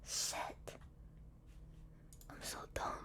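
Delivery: whispered self-scolding; whispering. Real, not acted. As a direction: breathe it out under your breath. Berates herself after failed search.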